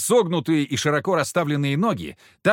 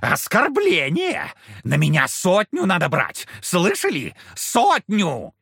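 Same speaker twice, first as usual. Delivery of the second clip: offended devil; 5 semitones higher, slower than usual. Acted. Devil offended by small squad.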